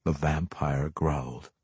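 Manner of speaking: plain reading voice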